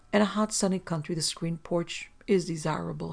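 plain reading voice

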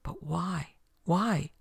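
incredulous why with a swooping pitch; baffled questioning. A narrator's reading. Despard left behind with Bonesteel, bewildered questioning. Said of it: "And that was Despard".

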